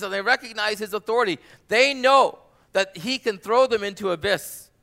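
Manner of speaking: loud, high, urgent sermon voice; fervent preaching. Acted. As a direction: drive the story forward with fire. Preaching at full force.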